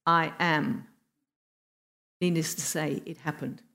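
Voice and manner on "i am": low, measured "I am"; solemn answer. Retold or imitated. Mysterious voice answering the warlord, low and solemn. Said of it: "The voice said".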